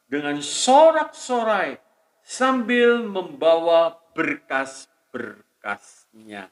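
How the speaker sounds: high, loud, slow proclamation of scripture; slow, soaring sermon proclamation; preaching at full force. Acted. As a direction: ring out the promise like a herald. Proclaims Psalm promise.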